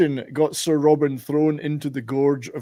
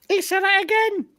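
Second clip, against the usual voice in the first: very high, squeaky alarm; squeaky knight voice. Acted. Knights of Ni bit.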